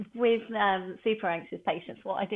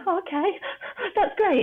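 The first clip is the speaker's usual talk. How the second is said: much higher, chirpy relief with a laugh; relieved patient; 12 semitones higher than usual. Retold or imitated. Calmed patient answering a reassuring voice, high and laughing.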